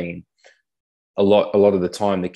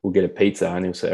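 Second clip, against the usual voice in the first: ordinary talk, nothing marked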